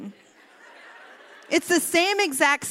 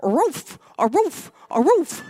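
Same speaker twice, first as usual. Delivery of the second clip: higher, louder cartoon puppy voice; little dog Smuggles; 4 semitones higher, 7 dB louder, slower than usual. Speaker voices the dog cheering up Nathaniel, puppy voice. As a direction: comfort him like an eager little dog. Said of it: "I am Nathaniel's little dog Smuggles".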